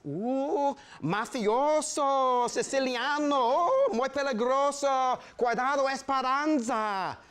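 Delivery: high, mocking singsong chorus of women; hair-salon women teasing the boy, high and mocking; teasing ladies. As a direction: tease him in a high crowing voice.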